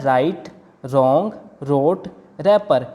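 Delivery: राइट, रॉन्ग, रोट, रैपर each starting on R; no W sound in राइट, रॉन्ग, रोट, रैपर; silent W. Shown as a demonstration. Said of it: "डब्ल्यू की कोई sound नहीं आएगी".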